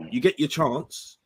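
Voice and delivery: ordinary talk, nothing marked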